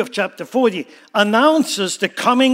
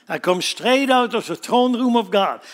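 usual speaking voice

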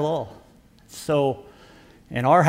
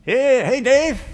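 higher, louder forced greeting; mock cheery reply; 10 semitones higher, 6 dB louder, much slower than usual. [name] answering Dave on the stairs, higher forced cheer. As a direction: answer back with put-on cheer. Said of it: "I'm like".

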